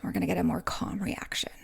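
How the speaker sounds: hushed, calm whisper; soft whisper to show a calm tone. Shown as a demonstration. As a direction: say it gently and calmly, almost whispering. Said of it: "in a non-irritated tone".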